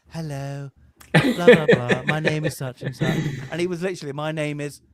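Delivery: put-on posh English recording voice. Father mimicking his son's voice note, posh English. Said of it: "He has this posh voice".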